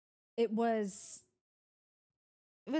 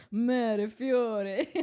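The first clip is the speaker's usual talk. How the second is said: hammy Italian lover voice; mock Italian accent, laughing through it; 4 dB louder than usual. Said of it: "Massimo's like".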